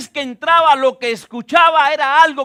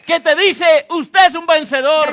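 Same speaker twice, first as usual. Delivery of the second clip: forceful, raised declaration; impassioned preaching; 3 semitones higher, 3 dB louder than usual. Real, not acted. Preacher telling the congregation they are conquerors, impassioned proclamation. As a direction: proclaim it boldly to the whole house.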